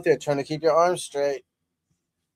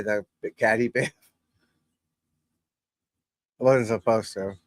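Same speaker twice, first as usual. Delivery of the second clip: ordinary delivery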